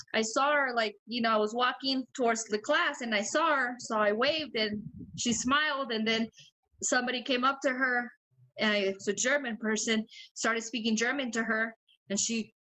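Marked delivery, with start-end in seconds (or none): none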